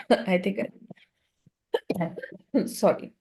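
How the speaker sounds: strained voice from a sore throat. Real, not acted. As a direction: speak through a sore throat. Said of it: "So the sore throat season is on".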